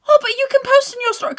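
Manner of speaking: fast, high mocking friend.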